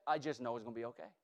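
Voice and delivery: soft, low, quick self-assurance; quiet confidence. Pretend. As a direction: murmur it to yourself, sure. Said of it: "All you know is that".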